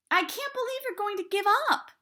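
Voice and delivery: very high, loud and outraged; outraged protest. A narrator's reading. Daughter furious her father is quitting the search, high and loud. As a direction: hurl the disbelief at him.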